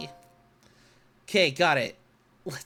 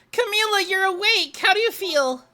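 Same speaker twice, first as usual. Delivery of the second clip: higher, louder voice for Lynn; Lynn relieved; 9 semitones higher, 4 dB louder than usual. Acted. Lynn relieved to see Camila awake. Voicing Lynn, happy reunion.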